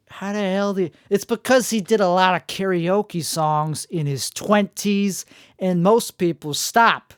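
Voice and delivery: usual speaking voice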